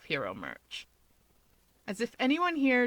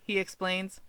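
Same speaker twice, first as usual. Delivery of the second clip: usual speaking voice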